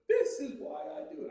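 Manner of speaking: a high, moved repeat, voice lifting; touched, almost tearful. Retold or imitated. Touched trumpeter watching the boy and his mother, voice lifting near tears. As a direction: repeat it as if tearing up over a child fan. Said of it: "I'm almost tearing up".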